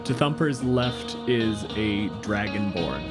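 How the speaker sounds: ordinary talk, nothing marked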